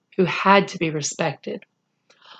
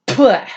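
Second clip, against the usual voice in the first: spitting sound; 4 semitones higher, 4 dB louder than usual. Acted. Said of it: "he actually spat".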